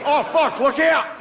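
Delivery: high-pitched panicked Australian; Australian accent. Acted. Australian office worker during a disaster, comically alarmed Australian accent. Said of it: "an Australian guy in the office".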